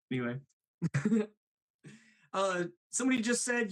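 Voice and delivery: usual speaking voice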